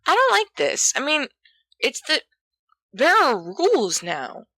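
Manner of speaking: put-on timid boy suddenly speaking up; clear Peter. A narrator's reading. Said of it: "with unexpected clarity".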